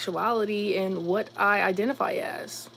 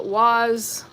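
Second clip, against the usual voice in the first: ordinary talk, nothing marked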